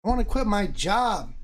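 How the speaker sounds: high, loud, thrilled retort; excited reply. Retold or imitated. Husband retelling his thrilled answer to his wife, high and loud. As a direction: brag like you just got rich. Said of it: "And I'm like".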